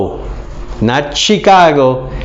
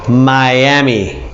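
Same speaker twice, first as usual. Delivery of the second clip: ordinary delivery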